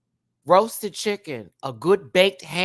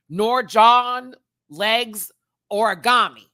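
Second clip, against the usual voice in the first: mock mangled name; 8 semitones higher, 7 dB louder, much slower than usual. Mocking mangled names.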